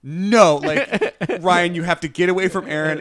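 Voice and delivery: loud, pleading no at the screen; mock alarmed yelling. Fan shouting at the TV to warn Ryan off. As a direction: yell a warning at the TV character. Retelling own reaction watching live.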